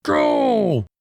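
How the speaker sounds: high, drawn-out cartoon holler; exaggerated imitation of yelling; mock yelled order. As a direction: bellow it long and high like barking orders. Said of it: "He's just yelling orders".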